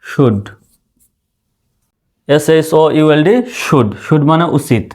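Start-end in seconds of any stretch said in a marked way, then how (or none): 0.0-0.4 শুড alone, lower, as "shud"; isolated "shud" without l; model pronunciation. Shown as a demonstration. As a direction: say শুড low and apart, l silent. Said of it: "শুধু শুড".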